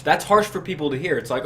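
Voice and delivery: normal talking voice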